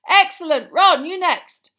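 high, loud praise and command; brisk rousing classroom yell; excited teacher's shout. A narrator's reading. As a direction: shout approval, then call the next one up. Teacher urging next pupil on.

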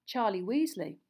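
ordinary delivery

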